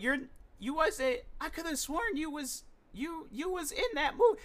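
high squeaky backpedal; flustered interviewer. Retold or imitated. Interviewer caught out, stammering in a high voice. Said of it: "He's like".

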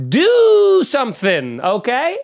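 loud, high, slow demand; frustrated shout. Real, not acted. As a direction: yell it at them, fed up. Ranting at North Korea.

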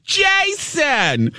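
very high delighted squeal of a name; screechy Marianne. Retold or imitated. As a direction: shriek his name like an overjoyed mom. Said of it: "a really screechy voice".

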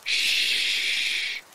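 sharp unvoiced shh; stern shush. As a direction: hush friends sternly. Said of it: "sternly let out a".

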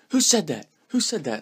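high, demanding coach's outburst; mock angry coach. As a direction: bark the question at the crowd. Coach demanding who spoke.

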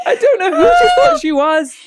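high, loud, laughing indignation. Real, not acted. Speaker cracking up about the manager who mocked her, laughing. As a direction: squeal it out through laughter.

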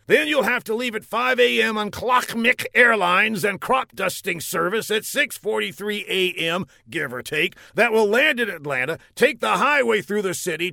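high general voice. Acted. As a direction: list the route like the general. General offers flight.

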